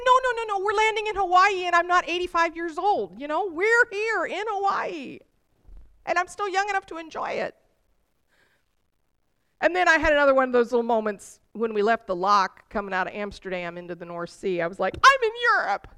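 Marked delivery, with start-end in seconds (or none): 0.1-7.6 wife reassuring her husband, high and emotional; overjoyed tearful insistence. Retold or imitated. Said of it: "I burst into tears". 15.0-15.9 high, louder exclamation of joyful amazement; leaving Amsterdam, overwhelmed, high and tearful; tearful wonder. Retold or imitated. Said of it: "tears falling down my cheeks".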